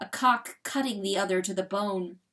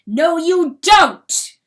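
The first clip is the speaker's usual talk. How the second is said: loud, high, drawn-out angry shout; furious refusal; 8 semitones higher, 15 dB louder, slower than usual. Acted. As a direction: shout the denial slowly and furiously. Rooster rejects coyote's love, attacks.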